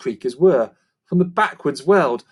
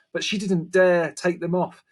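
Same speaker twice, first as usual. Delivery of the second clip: plain reading voice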